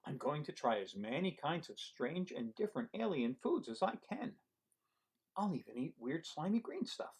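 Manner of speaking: lively storybook read-aloud; reading to children. Acted. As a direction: read it to a room of kids. Reading picture book aloud.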